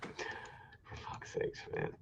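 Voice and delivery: exasperated curse under his breath, very slow; whispered curse. Real, not acted. Exasperated host curses under his breath, whispered. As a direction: breathe it out almost soundless. Muttered aside while co-host talks.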